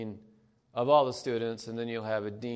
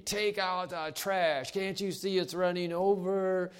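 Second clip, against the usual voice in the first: mock screaming; 8 semitones higher than usual. Family member yelling about the overflowing trash, mock scream. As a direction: snap the order, irritated. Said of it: "it's just screaming".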